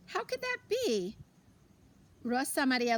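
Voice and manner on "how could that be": high-pitched bewildered question; puzzled surprise. Acted. Grandmother puzzled at the spilling candy, high surprise. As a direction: rise up in baffled wonder.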